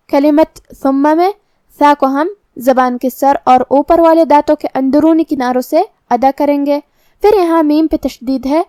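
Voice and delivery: ordinary delivery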